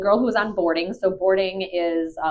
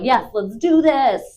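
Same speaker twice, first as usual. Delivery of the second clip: drawn-out, high, loud mock enthusiasm; imitating eager dog; 6 semitones higher, 6 dB louder, slower than usual. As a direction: slowly pump it up as a confident, happy dog. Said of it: "So happy, so confident, so excited".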